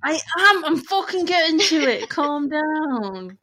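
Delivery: loud, high-pitched snapping back; exasperated retort. Real, not acted. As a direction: protest loudly at being nagged. Snaps back at nagging co-host.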